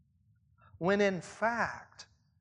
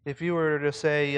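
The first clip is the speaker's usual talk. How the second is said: usual speaking voice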